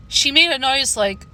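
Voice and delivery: usual speaking voice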